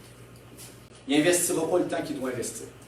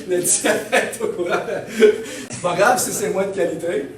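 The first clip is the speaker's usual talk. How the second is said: laughing self-mockery; 5 semitones higher, 6 dB louder than usual. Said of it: "on dit, bon".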